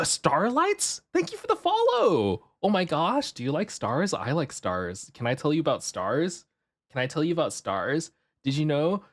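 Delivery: high, eager fangirl gush; cutesy excited voice. Pretend. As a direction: gush about stars like an eager kid. High excited cute voice to chat.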